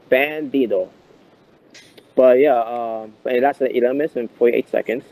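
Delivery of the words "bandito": "Bandito" drawn out slowly, each syllable clear; real slowly. Shown as a demonstration. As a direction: stretch "Bandito" out, over-enunciated. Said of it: "say it, uh, real slowly".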